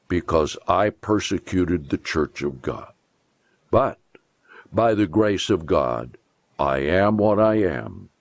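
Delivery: normal talking voice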